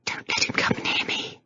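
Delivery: frightened breathy whisper. A narrator's reading. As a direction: whisper it, scared. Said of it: "she whispered".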